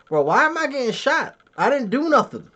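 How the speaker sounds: high-pitched indignant plea; mock protest. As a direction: whine innocence in playful outrage. Mock protest at being picked on.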